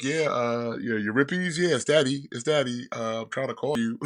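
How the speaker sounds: put-on father phoning kids. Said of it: "trying to call all of his kids".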